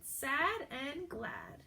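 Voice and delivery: "Sad and glad" drawn out very slowly; teacher slowing "Sad and glad" so kids hear the rhyme; slow and deliberate. Shown as a demonstration. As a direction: stretch out "Sad and glad" word by word. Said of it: "these two are rhyming words".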